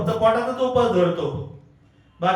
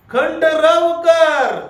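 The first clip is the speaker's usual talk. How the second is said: slow, loud scripture line; slow emphatic reading; 7 semitones higher, 6 dB louder, much slower than usual. Shown as a demonstration. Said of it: "एक, एक आहे ना?".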